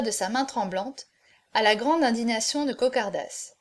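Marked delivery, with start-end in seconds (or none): none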